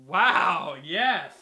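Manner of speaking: thrilled loud wow at the pick; excited cheer. Real, not acted. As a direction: burst out in approval. Friend names a shared favourite.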